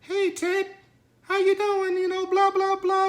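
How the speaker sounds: put-on very high falsetto girlfriend voice; mock a chirpy hello in high falsetto; put-on high-pitched woman's voice; imitating girlfriend. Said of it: "she, like".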